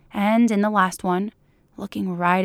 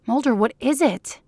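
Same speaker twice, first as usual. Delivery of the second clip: higher, impatient question leaning on "is"; demanding; 4 semitones higher than usual. A narrator's reading. Scully cannot see what Mulder is holding, demanding. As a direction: press him impatiently for an answer. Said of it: "she demanded".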